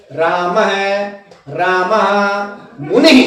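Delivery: slow राम है, रामः मुनि as "raamaha, raamahaa, munihi"; visarga echoing vowels. Shown as a demonstration. Teacher reviewing examples, slow echoed endings. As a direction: echo each final vowel after h in राम है, रामः मुनि. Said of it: "बोला ऐसे जाएगा".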